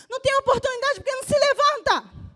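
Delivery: loud, driving sermon climax; fiery preaching. Real, not acted. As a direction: preach it hard to the church. Pastor preaching at full force. (pregação inflamada e forte)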